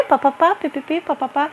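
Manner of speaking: high sing-song pipipi papapá babble; mocking gossip noises; mock chatter. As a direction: mimic idle gossip as silly babble. Mocking staff chatting with parents.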